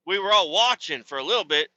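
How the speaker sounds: normal talking voice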